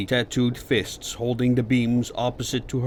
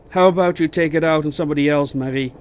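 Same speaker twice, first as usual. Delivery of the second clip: quick, louder comeback; irritated retort; 4 semitones higher, 7 dB louder, faster than usual. A narrator's reading. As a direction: fire back at a taunt. Inmate snaps back at taunt.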